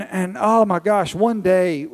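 ordinary talk

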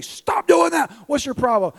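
loud, high-pitched parental outburst; exasperated yell. Retold or imitated. Frustrated father to his young son, exasperated yell. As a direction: snap and yell at the kid. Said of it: "to yell at David and say".

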